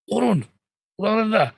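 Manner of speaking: high, forceful drill call; trainer's command. Acted. Trainer pressing a trainee to the floor, shouted command. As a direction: order the trainee down.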